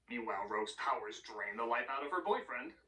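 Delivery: ordinary talk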